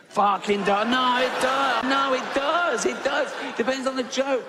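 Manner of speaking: high, emphatic insistence; exasperated insistence. Real, not acted. Comedian insisting to audience, high exasperated insistence.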